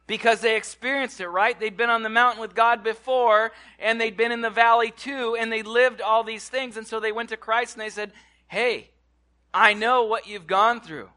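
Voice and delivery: rising preacher's fervour; preaching at full force. Real, not acted. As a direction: drive the point home to the church.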